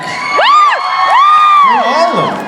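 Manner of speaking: high, drawn-out fan yell; excited shout. Real, not acted. Fan wanting all the doctors back, shouting excitedly.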